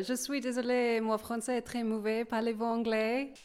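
French pronunciation on request; phrase spoken in French. Shown as a demonstration. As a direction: say it in French, "Je suis désolée" with French sounds. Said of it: "Can you just say it for the listeners".

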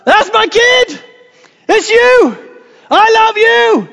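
high, loud whooping from the back of the hall; proud cheering dad. Retold or imitated. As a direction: cheer like a thrilled father at graduation. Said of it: "They're the one at the back going".

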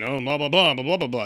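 singsong run of mock gibberish; dismissive blah-blah. Podcaster waving away a movie's dumb science, dismissive. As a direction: rattle it off as pure nonsense.

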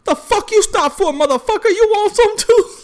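high, loud mock challenge; angry threat. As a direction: snap it high and loud at the guy filming. Man confronts the filming narrator.

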